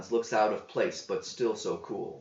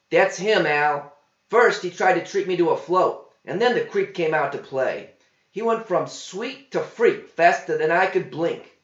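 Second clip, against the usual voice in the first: higher, louder tattling waitress; accusing waitress; 7 semitones higher, 7 dB louder than usual. A narrator's reading. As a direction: point him out to the cook.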